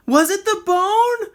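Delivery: high, loud holler. A narrator's reading. Rocket hollering at the bird, high and loud. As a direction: shout it up the tree. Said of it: "Rocket hollered".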